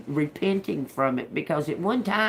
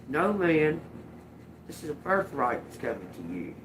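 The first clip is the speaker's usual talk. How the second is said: usual speaking voice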